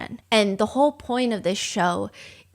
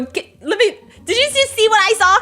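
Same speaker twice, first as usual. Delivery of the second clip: excited disbelief; 10 semitones higher, 5 dB louder than usual. Retold or imitated. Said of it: "and you go".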